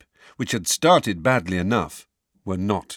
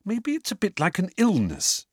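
put-on worried girl voice; 10 semitones higher than usual. A narrator's reading.

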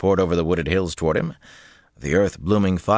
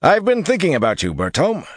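high-pitched, louder hearty address; 12 semitones higher, 4 dB louder than usual. A narrator's reading. Wyraman addresses Bertholm, hearty and high. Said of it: "he said heartily".

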